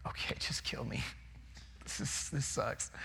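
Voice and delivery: breathy whisper, giving up; whispered surrender. Pretend. Preacher imagining himself inside the fish, whispering. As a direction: whisper it like you've lost all hope. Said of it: "I think I would actually be like".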